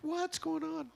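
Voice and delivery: mock-alarmed falsetto; comic high-pitched bafflement; startled bewilderment. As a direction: squeal it very high, as if waking to a shock. Retold waking up to looming statues.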